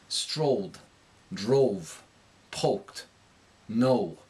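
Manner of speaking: darkened O in "Strolled, drove, poked, no", New York accent; New York accent word list, each O darkened before it rounds. Shown as a demonstration. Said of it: "it's gonna darken before the O".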